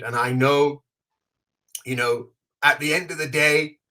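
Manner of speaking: ordinary talk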